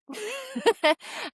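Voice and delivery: high, bright burst of laughter; amused laugh. Real, not acted. Nurse laughing about her boss's surprise, high laugh. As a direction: laugh at how surprised the boss was.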